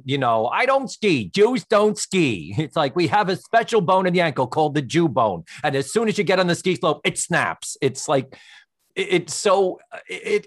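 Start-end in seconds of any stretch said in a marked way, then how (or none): 0.5-2.5 higher, louder, slowed comic punchline; Buddy Hackett. Retold or imitated. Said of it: "Buddy Hackett used to do". 2.9-7.8 Buddy Hackett. Retold or imitated. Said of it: "Buddy Hackett used to do".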